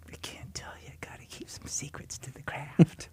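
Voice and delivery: whispered, sly aside; conspiratorial whisper. Retold or imitated. Actor answers the director about his acting secret, whispering.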